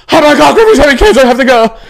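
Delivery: hammed-up panicking mother; mom's voice. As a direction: squeal it like a frantic mom on the phone. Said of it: "you know how mom's voice is?".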